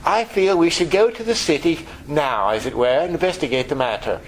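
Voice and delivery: put-on prim, composed voice; mock stuffy understatement of big news; mock unhurried propriety. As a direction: play it absurdly formal and unruffled. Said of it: "They're not gonna talk like that".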